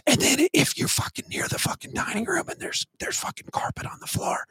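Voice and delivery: breathy mock-ASMR whisper. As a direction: whisper it close to the mic, hammed-up. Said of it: "I'm just gonna go A S M R".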